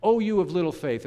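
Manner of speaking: loud, stern rebuke to a doubter; condemning rebuke. Shown as a demonstration. Jesus rebuking a sinking Peter, loud and condemning. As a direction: scold it like a harsh judge. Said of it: "something of a condemning word".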